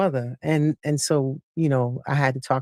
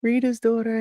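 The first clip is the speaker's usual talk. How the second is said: at the cousin's door; 8 semitones higher than usual. Retold or imitated. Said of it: "And I was like".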